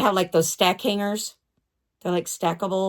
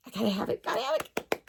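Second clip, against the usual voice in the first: craving whisper; 8 dB quieter, much faster than usual. Real, not acted. Craving the calendar.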